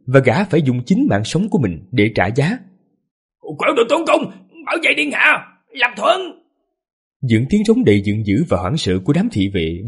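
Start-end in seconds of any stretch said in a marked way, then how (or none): 3.6-6.2 high barked commands; shouted orders. A narrator's reading. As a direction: call the guards to defend. Guards shout battle orders.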